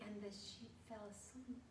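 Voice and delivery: soft, fading low voice; hushed low storytelling voice; low sleepy voice. Shown as a demonstration. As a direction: let it sink and fade away softly. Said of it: "my voice can go low".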